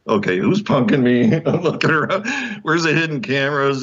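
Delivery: high, laughing incredulity; playful disbelief. Retold or imitated. Author offered a commercial, mock-suspicious. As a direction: act out suspecting a prank. Suspecting a prank, retold.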